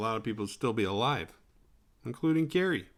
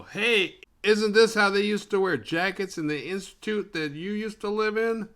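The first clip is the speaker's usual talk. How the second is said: put-on higher, louder taunting voice of a mean girl; 10 semitones higher, 5 dB louder than usual. Melissa mocking Tina before the crowd, high loud taunt. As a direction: jeer at her in front of everybody. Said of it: "Melissa's all".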